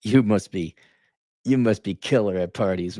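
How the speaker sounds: usual speaking voice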